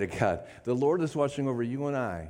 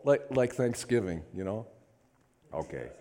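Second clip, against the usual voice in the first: ordinary talk, nothing marked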